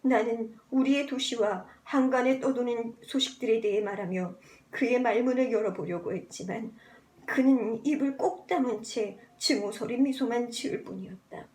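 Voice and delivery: ordinary delivery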